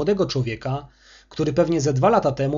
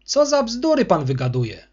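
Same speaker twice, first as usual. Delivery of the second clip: indignant; 7 semitones higher, 3 dB louder than usual. Acted. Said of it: "obruszył się kurator".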